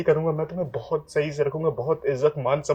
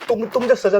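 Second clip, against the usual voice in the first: desperate pleading; 8 semitones higher than usual. Acted. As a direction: beg her urgently to believe you. Desperate husband insists. (बेताबी से गिड़गिड़ाते हुए)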